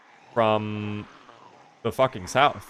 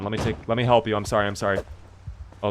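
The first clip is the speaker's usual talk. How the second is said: ordinary delivery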